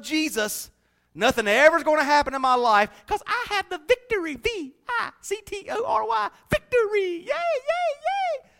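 mock a naive victory preacher; mock cheerleader. Mocking prosperity-gospel cheer.

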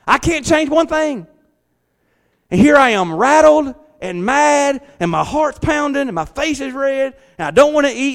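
rising frustration. Acted. Preacher building to frustration.

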